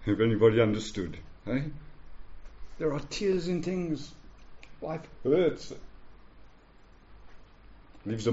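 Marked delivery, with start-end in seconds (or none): none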